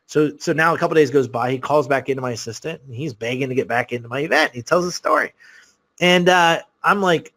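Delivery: normal talking voice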